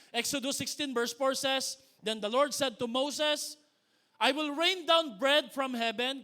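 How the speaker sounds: loud, slow scripture reading; preaching. Acted. Pastor reading Exodus to congregation, loud and slow. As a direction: declaim the verse slowly to the church.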